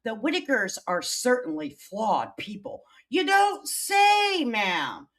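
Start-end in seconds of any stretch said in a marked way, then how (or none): 3.1-5.1 high, loud and drawn-out sarcasm; sarcastic shout. Real, not acted. Streamer mocking a Reddit commenter, loud sarcasm.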